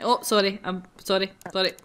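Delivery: ordinary talk, nothing marked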